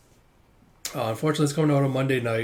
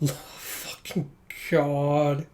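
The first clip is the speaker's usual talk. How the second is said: ordinary talk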